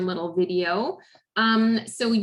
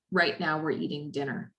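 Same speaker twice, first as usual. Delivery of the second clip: neutral, low and soft instruction; calm voice; 4 semitones lower, 5 dB quieter than usual. Shown as a demonstration. Therapist models guiding a child back to the table, calm. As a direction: say it quietly and evenly, no fuss. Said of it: "that it's in a calm voice".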